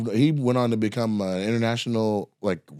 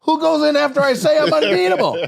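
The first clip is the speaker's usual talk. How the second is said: very high, loud outraged challenge; imitated indignant shout; 6 dB louder than usual. Poker opponent challenging whoever bet after him, high indignant mock shout.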